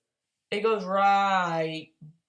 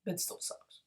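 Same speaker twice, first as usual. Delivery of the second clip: whisper; 13 dB quieter than usual. Real, not acted.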